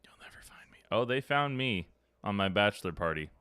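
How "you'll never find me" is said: very soft teasing whisper; playful whisper from a hiding spot; mock whisper. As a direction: breathe it like a kid hiding.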